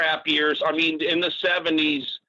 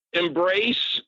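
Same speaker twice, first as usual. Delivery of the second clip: ordinary talk, nothing marked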